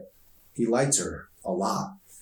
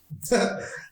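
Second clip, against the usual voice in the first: short breathy teasing laugh; a quick airy chuckle at him; little unvoiced laugh; breathy giggle; 13 semitones higher than usual. A narrator's reading. Emery laughs at her joke.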